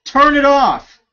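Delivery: high, loud, irritated call about the heater; shouting across the house. Real, not acted. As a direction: bark it so Ricky hears you downstairs. Shouting order to Ricky.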